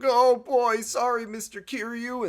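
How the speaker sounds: high, loud panicky apology; mock frightened punks apologizing to a thug; scared party boys. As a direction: squeak out the sorry. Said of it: "the guys are like".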